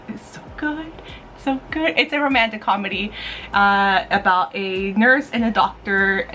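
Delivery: ordinary talk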